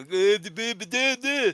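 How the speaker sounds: high, loud nonsense babbling; mocking babble. Podcaster imitates the skit kid's babble, high mocking voice. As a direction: babble crudely in a put-on high voice. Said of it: "acting like a very bad R word stereotype, going".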